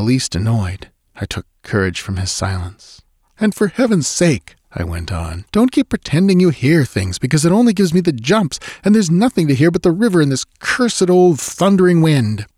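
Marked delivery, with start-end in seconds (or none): 3.4-12.5 much higher, louder, rattled; angry protest. Acted. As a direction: snap at him, nerves frayed. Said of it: "impatience and a strain of anger".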